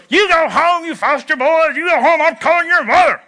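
high, loud shrill-toned scolding; irate neighbour mother threatening to call their mom. Retold or imitated. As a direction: shoo the foster boys off her driveway. Bully's mother scolding the boys.

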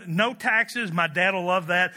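normal talking voice

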